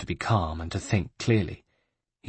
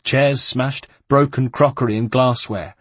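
normal talking voice

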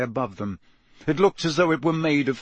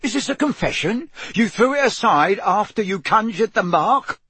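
high, louder interrogation; disbelieving accusation; 8 semitones higher, 4 dB louder than usual. A narrator's reading. Mister Diggory interrogates Harry about the wand, disbelieving accusation. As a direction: press the accusation, voice raised. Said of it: "Mister Diggory in disbelief".

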